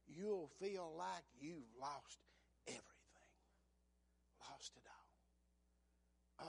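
soft, slow whisper; hushed preaching. Real, not acted. Preacher telling congregation they'll lose everything, whispered. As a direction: draw them in with a whisper. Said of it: "preacher hushes for effect".

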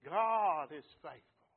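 slow, loud proclamation; preached shout. Acted. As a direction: shout it slowly to the church. Repeated line, quiet then shouted.

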